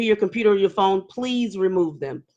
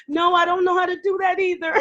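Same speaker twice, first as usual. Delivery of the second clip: flustered refusal; 8 semitones higher, faster than usual. Retold or imitated. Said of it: "It's like".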